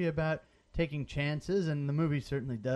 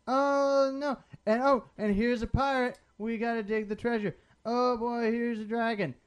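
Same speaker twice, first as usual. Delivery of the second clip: kid playing pretend; 6 semitones higher than usual. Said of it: "sounds like a bored kid, like, playing imagination games".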